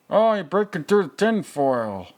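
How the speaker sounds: high, loud burst of delight; thrilled movie-watcher yelling at the screen; excited cheer. Real, not acted.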